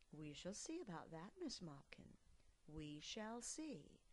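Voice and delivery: soft, calm mother bear; unruffled and patient. Acted. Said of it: "Mother said calmly".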